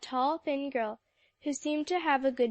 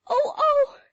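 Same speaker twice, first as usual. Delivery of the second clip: much higher, louder frightened cry; little scream; 10 dB louder than usual. A narrator's reading. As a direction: squeal in alarm at the hens. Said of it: "Sally gave a little scream".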